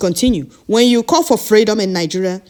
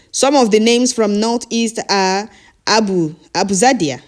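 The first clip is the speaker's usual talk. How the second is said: ordinary delivery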